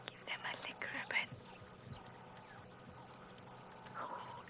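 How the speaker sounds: hushed, thrilled whisper; soft, breath-held wonder; excited whisper. Real, not acted. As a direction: whisper so the cubs aren't scared. Hushed watching of shy cubs.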